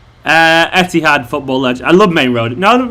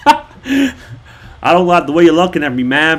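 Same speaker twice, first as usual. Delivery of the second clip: Texas accent. Shown as a demonstration. Texan confronting a stranger, put-on Texas accent. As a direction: talk like a wary Texan. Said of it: "Apparently the Texas is good".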